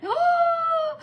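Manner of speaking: high, loud startled "Oh"; a big shocked gasp of a word. Retold or imitated. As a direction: squeak it out in sudden shock. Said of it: "And I went".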